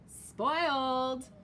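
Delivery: teasing jab. Acted. Waffle teases Milk.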